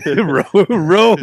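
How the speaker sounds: saying it through laughter; laughing. Real, not acted. Host repeats his silly word to co-host, laughing. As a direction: crack up on each word. Cracking up over the word rows.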